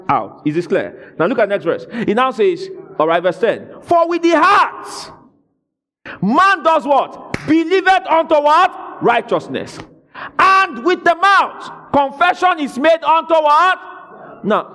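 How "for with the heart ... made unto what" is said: high, loud, slow call-and-response; preaching at full force. Acted. Preacher quizzing the congregation on the verse, loud and drawn-out. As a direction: fling each question out to the congregation.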